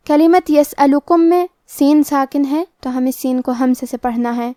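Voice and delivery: normal talking voice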